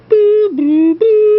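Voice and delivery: very high, loud ghost boos; ghostly boo. Pretend. Host ending the show with loud ghostly boos. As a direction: wail like a cartoon ghost. Spooky outro after show name.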